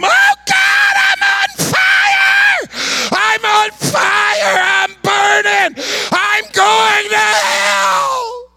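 high, drawn-out scream of agony. Retold or imitated. Dying man screaming that he is on fire, agonized wail. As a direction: imitate a blood-curdling scream, stretching every word. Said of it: "he began to scream".

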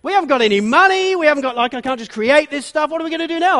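high, loud panicky pleading; a mock panic over feeding the crowd; panicking. Disciples panic to Jesus about the food, high and loud. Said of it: "they start to panic".

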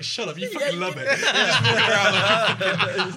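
high, loud laughing jab; laughing, high-pitched taunt; laughing mock retort. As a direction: tease through laughter.

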